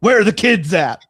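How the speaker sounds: loud, high-pitched demand; eager mock call. Childless visitor at a daycare asking for the kids, loud mock call.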